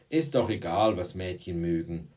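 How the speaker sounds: ordinary delivery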